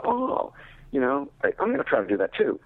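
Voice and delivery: high "oh" of discovery, then eager resolve; sudden realization. Retold or imitated. Student hearing a buddy's reading tip, sudden realization.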